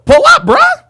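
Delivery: loud, high-pitched call-out; high yell rising at the end; shouted challenge. Real, not acted. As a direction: dare them to show up, shouting. Challenging viewer to join debate.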